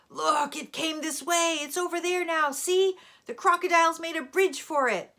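high, loud cry of alarm; screaming wild boar. A narrator's reading. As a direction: shout the alarm like an angry boar. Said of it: "he heard a wild boar scream".

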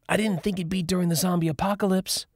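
put-on high bright girl voice; Dion's voice. A narrator's reading. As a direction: pitch it up for Dion. Dion voiced, high and different.

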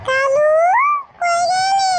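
loud, high-pitched yell for a missing brother; frantic searching shout; calling out. Acted. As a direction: holler across a distance, pitch climbing. Calling for his fleeing brother.

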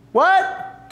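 high, baffled "What?"; the employee didn't catch it at all; confused "what?". Acted. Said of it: "the team member says".

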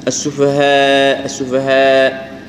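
السفهاء drawn out slowly, alif kept thin; slow correct reading. Shown as a demonstration. Teacher models السفهاء slowly. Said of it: "ما نقول سفهاء".

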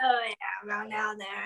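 whispered, mumbled seductive growl imitation; barely intelligible sultry whisper; sultry Batman voice. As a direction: murmur it like a seductive superhero. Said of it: "sultry Batman voice on where you need subtitles".

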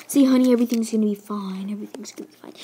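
hushed, fast reassuring whisper; soothing whisper. Acted. Owner whispering comfort to upset pet, soft whisper. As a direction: whisper it to calm her down.

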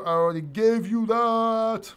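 high, loud, drawn-out complaint; mock exasperation. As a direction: stretch it out in playful exasperation. Exasperated at a repeated chat question.

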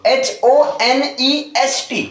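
slow letter-by-letter spelling. Shown as a demonstration. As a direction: spell it out slowly, each letter apart. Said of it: "जैसे मैं लिख दूं". (अक्षर-अक्षर धीरे बोलना)

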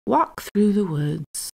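plain reading voice

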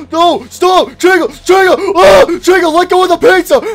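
high, loud desperate yelling; frantic shouting. Acted. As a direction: yell at her to drop it. Stopping Chica stealing pizza.

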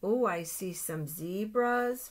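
usual speaking voice

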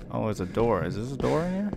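usual speaking voice

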